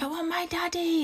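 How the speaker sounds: put-on high, soft whiny toddler voice; mimicking toddler. Mum imitating her son asking for daddy, whiny. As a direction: whine it like a small child.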